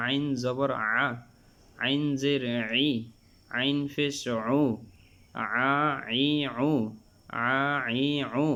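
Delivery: "আ, ই, উ" squeezed from mid-throat; Arabic ain in "আ, ই, উ", made with throat pressure; pressed throat ain. Shown as a demonstration. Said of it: "গলার মধ্য থেকে চাপ দিয়ে".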